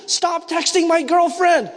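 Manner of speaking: high, slowed mock-angry outburst; angry all-caps message. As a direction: read an all-caps text as a high-pitched rant. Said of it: "I get an angry, all caps message".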